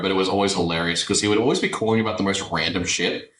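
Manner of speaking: ordinary talk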